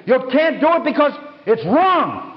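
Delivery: high, heated retort; angry outburst. Retold or imitated. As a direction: imitate an opponent driven to fury. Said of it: "He got that angry".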